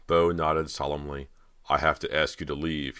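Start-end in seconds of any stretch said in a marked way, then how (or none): none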